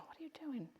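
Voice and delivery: whispered alarm, imitating a mom; mock hushed mom. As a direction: hiss it like a worried mother. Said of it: "the mom will freak out and go".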